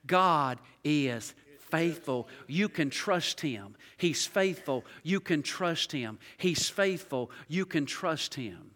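low, soft and slow repetition; whisper-close comfort to the church; quiet tender assurance. Acted.

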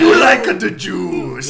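put-on Mario accent. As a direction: drawl it as a cartoon Italian Roman. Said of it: "Mario accents".

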